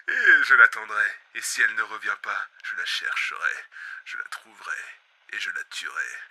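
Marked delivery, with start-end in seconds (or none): 2.6-6.2 low, soft, deadly calm; menacing whisper. Acted. Host quietly vowing to kill the bug. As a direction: promise death in a hush. Quiet threat after the outburst.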